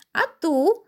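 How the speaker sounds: high, teasing call. A narrator's reading. Invisible Karolcia teasing Piotr, calling out. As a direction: sing out from a hiding place. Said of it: "zawołała Karolcia".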